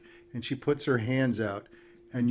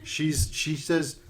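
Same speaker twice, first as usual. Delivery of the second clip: ordinary delivery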